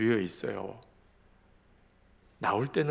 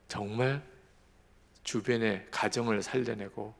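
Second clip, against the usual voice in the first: usual speaking voice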